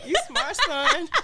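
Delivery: mock proud quote. Praising the kid's trick.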